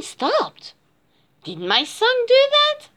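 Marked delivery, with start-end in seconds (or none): 1.4-2.9 high-pitched amazed question; wondering. A narrator's reading. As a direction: ask in wonder, voice lifting high. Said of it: "Kat wondered".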